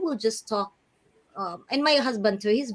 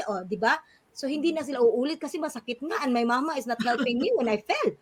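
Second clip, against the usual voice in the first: ordinary talk, nothing marked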